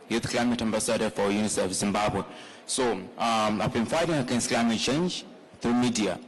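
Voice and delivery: normal talking voice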